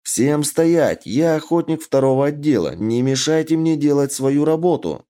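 mildly acted official command; commanding call. A narrator's reading. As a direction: order the crowd back like an officer. Officer halts a crowd mid-fight.

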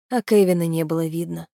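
ordinary delivery, nothing marked

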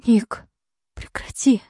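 faint high breathy plea; barely-there weak cry. Acted. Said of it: "слабый голос Лилианы".

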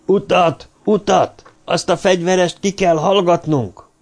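loud, high-pitched urgent command; stentorian shout. A narrator's reading. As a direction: call out to clear a way through a crowd. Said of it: "stentori hangon kiáltozta".

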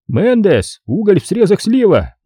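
high-pitched garbled greeting; brisk high-pitched official on the line; detective's phone voice. A narrator's reading. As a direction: announce name and department high. New detective answers the phone.

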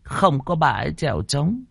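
put-on old midwife explaining to the grandson. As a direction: tell the hard truth gently. Old midwife voiced again.

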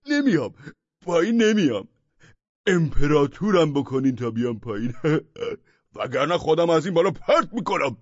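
high, loud whining demands; stubborn madman. A narrator's reading. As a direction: bargain like a spoiled madman.

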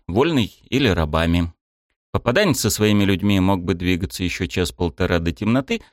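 usual speaking voice

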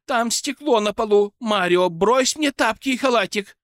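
high, loud startled cry. A narrator's reading. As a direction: yelp it in alarm. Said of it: "Затем женщина вскрикнула".

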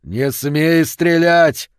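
loud, high, drawn-out yell. A narrator's reading. Father recoils and shouts a warning, drawn-out yell. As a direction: yell the order, stretching the words. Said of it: "завопил он".

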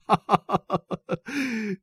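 high, rapid run of ha-ha laughter; father's amused laugh at his children; hearty acted laugh. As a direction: laugh out in a long high burst.

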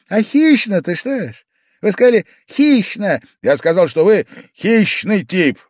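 high, loud, wheedling pestering; Pavel Pavlovich, needling drunkard. A narrator's reading. Drunk guest pestering Alexei Ivanovich to explain, high and needling. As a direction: press him in a high-pitched, clowning voice.

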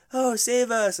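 high, imitated cry for help; mock plea for rescue. Friend acts out escapees begging soldiers for help, mock desperate cry.

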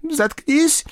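high, sudden outburst; agitated student's cracking-point yell; snapping. A narrator's reading. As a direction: lose control and snap. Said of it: "сорвался студент".

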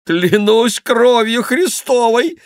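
very high, loud, drawn-out oath; amused exclamation. A narrator's reading. Favourite mocks his blue friend, loud high amusement. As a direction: crow the oath with loud, mocking glee. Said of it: "засмеялся Келюс".

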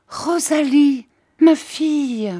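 high, loud cry of recognition. A narrator's reading. Jeanne recognizes her old servant, crying out. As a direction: exclaim it high, falling at the end. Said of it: "Et Jeanne s'écria".

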